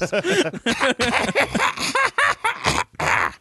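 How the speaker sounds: high cackling laugh; old woman laugh. Acted. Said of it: "She laughs with you guys".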